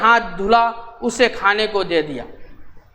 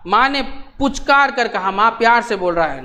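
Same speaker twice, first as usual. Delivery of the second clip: usual speaking voice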